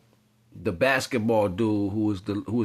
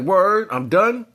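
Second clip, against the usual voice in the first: higher, slow nervous backing down; scared; 8 semitones higher, 4 dB louder, slower than usual. Retold or imitated. Marlon backing out of the tournament, scared and higher. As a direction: back off fast, spooked. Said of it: "Marlon got scared".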